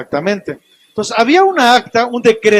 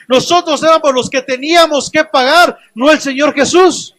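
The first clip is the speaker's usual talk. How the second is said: fervent preaching; 4 semitones higher than usual. Acted. Pastor exhorting the congregation that they are free, fervent. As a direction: drive it home to the congregation.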